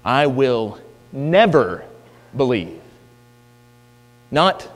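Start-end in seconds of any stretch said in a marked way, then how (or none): 0.0-2.8 slow, with "never" drawn out and punched; emphatic NEVER. Retold or imitated. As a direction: lean hard on "never" in "I will never believe". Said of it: "is even more emphatic".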